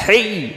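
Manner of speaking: a high, breathy হেই from the throat; breathy h sound. Shown as a demonstration. Teacher showing the breathy h, high. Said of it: "এমন আ-, আওয়াজ হবে".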